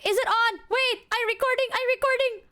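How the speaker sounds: hammed-up high panic; flustered. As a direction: play yourself panicking over a camera. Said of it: "And I'm like".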